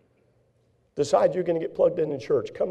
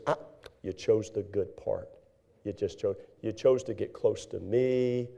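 gentle God voice; 3 semitones lower, 4 dB quieter than usual. Acted. God gently telling Mary she chose well, soft and low. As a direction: tenderly praise her choice.

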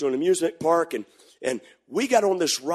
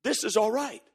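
inner thought; 7 dB quieter than usual. Retold or imitated. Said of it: "And I thought".

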